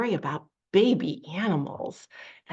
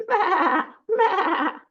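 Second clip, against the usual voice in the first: high, loud bleats; kid goat bleating; 10 semitones higher, 7 dB louder than usual. Acted. Teacher imitating baby goats for children, bleating. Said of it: "And they say".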